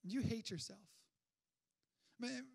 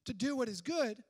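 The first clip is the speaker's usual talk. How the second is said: emphatic quote; 3 semitones higher, 7 dB louder than usual. Acted. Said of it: "He says".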